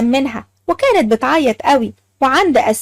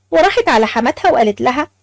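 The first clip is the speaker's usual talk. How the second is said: usual speaking voice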